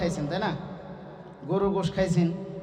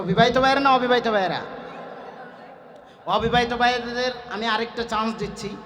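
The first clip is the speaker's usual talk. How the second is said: ordinary talk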